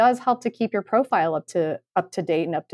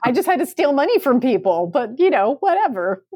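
breezy entitled voice, pitched higher; mock Anna Delvey; 6 semitones higher than usual. Host keeps mocking the scammer, higher breezy entitled voice. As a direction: toss it off like a careless heiress. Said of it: "sounds a lot like Anna Delvey".

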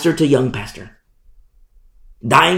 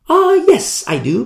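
pitched up, eager yes; eager reply; 13 semitones higher than usual. Acted. Paul answering the rabbi, eager and bright. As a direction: jump in keen to speak.

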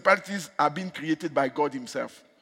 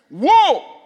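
long, loud, swooping "woe"; dramatic warning cry; 11 semitones higher, 12 dB louder than usual. Retold or imitated. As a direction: cry out the warning, rising then falling. Dramatic re-quote of scripture warning.

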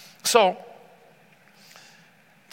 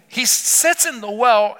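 ordinary delivery

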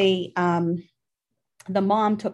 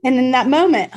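real choked-up voice, higher and louder; 5 semitones higher, 9 dB louder than usual. Real, not acted. Mom apologizing mid-story for getting emotional, choked up. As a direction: push on through a lump in the throat. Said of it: "I get choked up".

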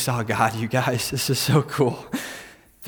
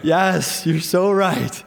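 sincere admission; 3 semitones higher, slower than usual. Retold or imitated. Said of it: "I'm like".

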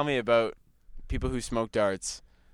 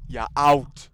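loud imitation of an irate mother; mum's angry yell; 3 semitones higher, 7 dB louder, much slower than usual. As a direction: bellow it like mum, drawn out. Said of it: "She's just like".

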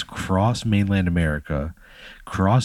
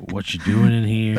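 mimicking silly doctor; 3 semitones higher than usual. Player mimics the doctor's voice, laughing.

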